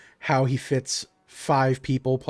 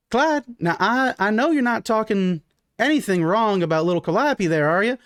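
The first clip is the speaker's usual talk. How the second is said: warning wife; 8 semitones higher than usual. Acted. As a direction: call out a pointed warning to your husband. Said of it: "And you hear from the kitchen".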